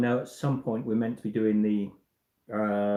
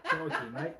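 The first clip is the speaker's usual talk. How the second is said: put-on Australian accent; 2 semitones higher, 6 dB quieter than usual. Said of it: "Australian accent".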